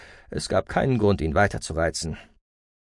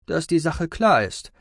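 usual speaking voice

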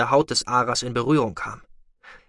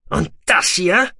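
loud, slow challenge, pitched up; fierce taunt; 6 semitones higher, 5 dB louder, slower than usual. A narrator's reading. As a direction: fling the question at the enemy. Taunting enemy with next glass.

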